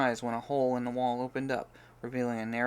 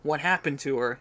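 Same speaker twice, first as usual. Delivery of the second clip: loud, insistent questions, slower at first; demanding; 3 semitones higher, 7 dB louder, slower than usual. Acted. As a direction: demand an answer from Jacob. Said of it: "he demanded".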